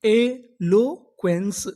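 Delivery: Eloquence drawn out very slowly; slow full word. Shown as a demonstration. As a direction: model eloquence slowly for the class. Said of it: "तो पूरी meaning क्या बनी?". (धीरे-धीरे उच्चारण)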